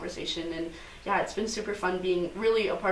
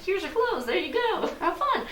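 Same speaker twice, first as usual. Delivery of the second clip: breezy dresser; 11 semitones higher than usual. Retold or imitated. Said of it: "I was like".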